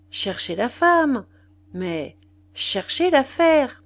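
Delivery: slow, loud, each word weighed; emphatic maxim. Acted. Wife lecturing husband on politics, slow and emphatic. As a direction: lay the maxim down slowly and loudly.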